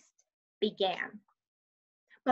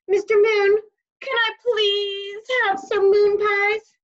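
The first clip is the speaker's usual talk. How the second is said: higher, louder and slow, spelling it out; 10 semitones higher, 8 dB louder, slower than usual. Acted. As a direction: take a deep breath and belt it slowly. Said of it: "took a deep breath and started again".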